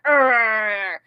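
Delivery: imitated fiery growl; drawn-out mock growl of fury. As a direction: growl like a temper flaring. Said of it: "the most gloriously fiery, just like".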